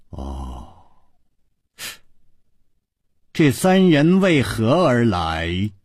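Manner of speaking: cold regal pondering. A narrator's reading. Ice queen pondering intruders.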